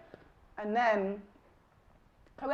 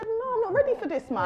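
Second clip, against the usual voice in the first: higher, louder cry of not being ready; mock panicked protest; 6 dB louder than usual. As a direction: protest in half-laughing alarm. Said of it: "I said".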